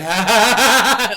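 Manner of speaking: loud, high burst of laughter; acted laughing fit. Man seeing only clouds cracks up, loud acted laughing fit. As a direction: burst out in big mock laughter. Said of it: "like, starts laughing".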